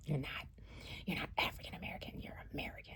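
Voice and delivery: hushed, fast whisper of the officer; retelling the rude customs officer in a whisper; whispered officer quote. Retold or imitated. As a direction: mimic the officer under your breath.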